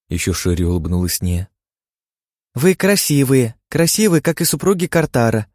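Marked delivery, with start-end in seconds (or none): 2.5-5.5 tiny fairy voice. Acted. Fairy compliments the wives.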